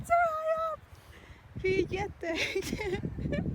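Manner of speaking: very high-pitched cry of awe; high, stretched exclamation of amazement; overwhelmed squeal. Real, not acted. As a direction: squeal it out, thrilled by the height. Reaching a high viewpoint, overwhelmed.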